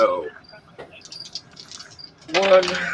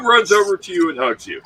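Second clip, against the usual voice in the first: normal talking voice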